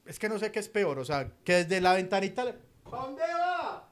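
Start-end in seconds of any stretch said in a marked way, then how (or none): 2.8-3.9 taxi driver. Retold or imitated. Taxi driver asking from window.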